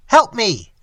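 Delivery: loud, high desperate cry; cry for help. Acted. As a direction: shout it as he sinks. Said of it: "cried Avusul Wutequan".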